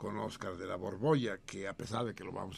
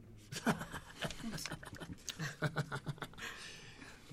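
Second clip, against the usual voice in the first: breathy high burst of laughter; wheezing laugh; big laugh; 7 semitones higher, 4 dB quieter than usual. Real, not acted. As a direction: laugh hard at the joke. Laughs at Putin riddle.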